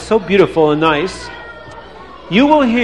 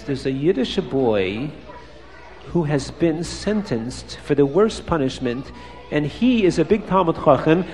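whispering; 3 semitones lower, 9 dB quieter than usual. Acted. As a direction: lean in so the guard can't hear. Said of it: "he whispers in his ear".

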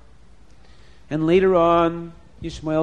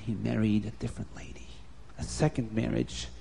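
hushed aside; 5 semitones lower, 9 dB quieter than usual. A narrator's reading.

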